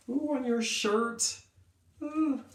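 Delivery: put-on light up at a child's answer; teacher thrilled by an answer; delighted ooh. Hearing kids' answers.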